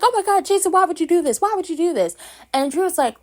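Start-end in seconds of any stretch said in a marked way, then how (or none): 0.0-2.2 higher and louder, aghast; hammed-up shocked family shouting at Jason; mock shocked outcry. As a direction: shriek it like horrified relatives. Relatives shocked at Jason's attack.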